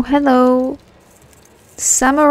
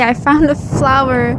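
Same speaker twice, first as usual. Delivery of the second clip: ordinary talk, nothing marked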